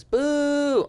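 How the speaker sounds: high, drawn-out imitated boo; mounting boo. Heckler's boos keep building.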